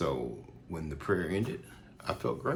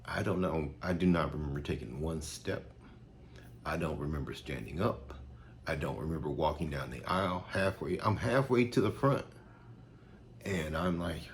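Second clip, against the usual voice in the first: ordinary talk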